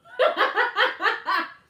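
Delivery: loud high burst of laughter; loud burst of laughing at her own joke; big laugh. Real, not acted.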